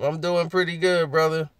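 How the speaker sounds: ordinary talk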